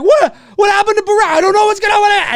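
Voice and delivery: loud, high, frantic fan; panicked fan outburst. Acted. Imagined fan reacting to a massive cliffhanger, frantic. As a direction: cry out like a fan left hanging.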